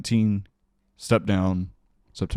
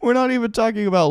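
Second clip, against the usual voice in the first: incredulous laughter; 13 semitones higher, faster than usual. Real, not acted. Laughing at the scandal.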